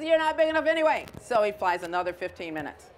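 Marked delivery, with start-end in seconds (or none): 0.0-1.1 much higher, louder and fast; grouchy ladybug sulking off from the whale, high and quick; huffy dismissal. Acted. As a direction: toss it off in a snit. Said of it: "He says".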